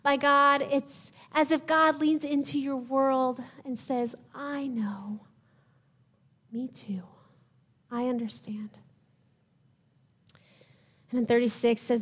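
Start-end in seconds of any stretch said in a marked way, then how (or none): 4.3-8.8 hushed, gentle reassurance, almost a whisper; tender comfort. Acted. God softly comforting a grieving believer, hushed and tender. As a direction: softly console someone grieving.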